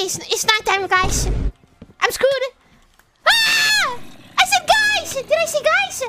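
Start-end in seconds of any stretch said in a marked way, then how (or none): 3.3-6.0 high-pitched alarmed yell; panicked shout. Real, not acted. Streamer catching a forbidden word, high panicked shout. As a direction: cry out in dismay.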